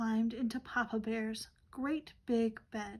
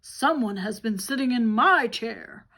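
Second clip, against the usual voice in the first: booming, much louder Papa Bear; Papa Bear bellowing about his porridge, much louder; great big Papa Bear voice; 10 dB louder than usual. Acted. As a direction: fill the room as the biggest bear. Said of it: "Papa Bear said in his great big voice".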